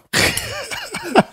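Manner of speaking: high wheezing laugh; helpless laugh. Real, not acted. Laughing at his friend's retort, high wheezing. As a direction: really lose it laughing. Laughing fit after the quote.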